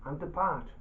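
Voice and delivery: usual speaking voice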